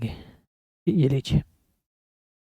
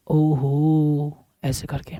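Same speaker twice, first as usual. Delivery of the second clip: mock surprise; 5 semitones lower, 9 dB quieter, much slower than usual.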